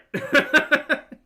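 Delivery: loud high burst of laughter; big real laugh. Real, not acted. Cracking up over spellings.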